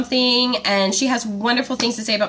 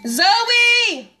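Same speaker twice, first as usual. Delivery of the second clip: high call across the house; 11 semitones higher than usual. Real, not acted. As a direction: call Zoe from afar. Calling daughter across house.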